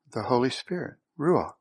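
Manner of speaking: normal talking voice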